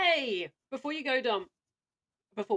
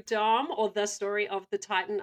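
ordinary talk

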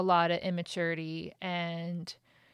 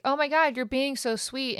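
higher and louder, sarcastic gushing; mock swoon; 6 semitones higher, 3 dB louder than usual. Wife mock-swooning over Ryan's coffee and kiss, sarcastic. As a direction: play the swooning wife, tongue in cheek.

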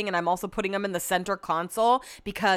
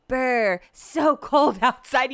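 hammed-up brr, a bit louder and higher, slowly; mock shivering; 5 semitones higher, 4 dB louder, much slower than usual. Joking to her friend while sweating, mock shivering. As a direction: pretend to freeze, laughing. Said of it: "visibly sweating".

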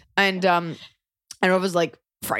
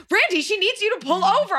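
mock yell; 13 semitones higher than usual. Mom yelling at stepdad.

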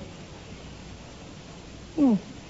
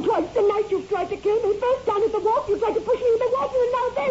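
higher, rushing, scared reproach; frightened accusation; 9 semitones higher than usual. Acted. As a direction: pile up the proof in fear. Wife lists husband's murder attempts.

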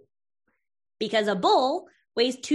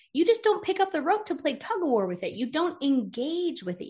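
ordinary talk, nothing marked

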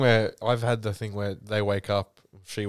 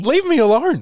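high, loud mock plea; hammed-up quarrel yell; exasperated outburst; 7 dB louder than usual. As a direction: cry out like a hounded boyfriend. Acted quarrel with dreaming girlfriend.

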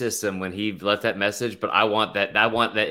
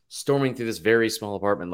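ordinary delivery

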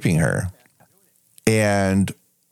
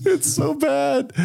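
laughing; slower than usual. Real, not acted. Laughing at cheesy movie.

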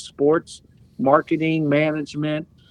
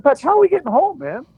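much higher, louder desperate question; exasperated; 13 semitones higher, 3 dB louder, much faster than usual. Retold or imitated. Stranded player asking teammate how to get home, high exasperated. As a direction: throw up your hands, stranded at dawn. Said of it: "I said".

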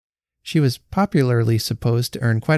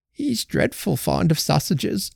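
put-on fretful old woman; 7 semitones higher, slower than usual. A narrator's reading. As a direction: croon it sadly as an old woman. Betsy croons her complaint.

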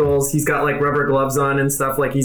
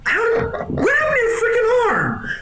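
Doctor Light; 14 semitones higher than usual. Acted. Scientist outraged at his stolen rail gun, rising to a shriek. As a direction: splutter in disbelief, climbing to a shriek. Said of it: "He's like".

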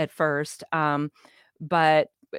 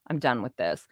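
usual speaking voice

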